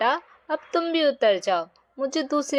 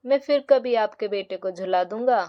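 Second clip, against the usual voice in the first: plain reading voice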